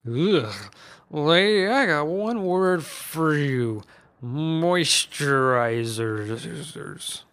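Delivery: slow, mocking old man voice; cranky uncle drawing out an insult; taunting Uncle Seamus. A narrator's reading.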